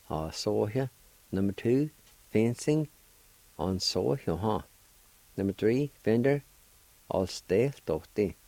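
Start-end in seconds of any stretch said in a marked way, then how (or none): none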